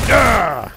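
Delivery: high, loud "ah"; startled yell. Real, not acted. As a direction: yelp loudly in sudden surprise. Startled in game.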